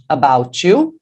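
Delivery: "About you" as one word, "abou-tchu"; linked sound. Shown as a demonstration. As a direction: join "About you" with a CH, rising at the end. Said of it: "enquanto você repete". ("About you" emendado com som de tchu)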